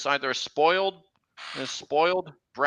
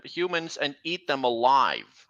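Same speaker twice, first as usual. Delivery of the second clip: ordinary talk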